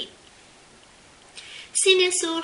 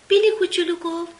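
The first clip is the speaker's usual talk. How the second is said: usual speaking voice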